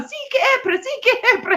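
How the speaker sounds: high laughing mangled Finnish; mock Finnish. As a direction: parrot the garbled Finnish, laughing. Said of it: "going on".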